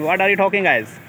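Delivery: ordinary talk, nothing marked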